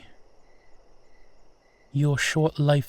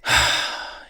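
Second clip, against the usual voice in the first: long breathy sigh. Acted. Arriving at the crossroad.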